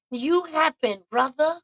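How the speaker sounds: YOU high and pointed; pointed retort. Real, not acted. Telling the ex he caused it, pointed. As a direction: throw it back at him.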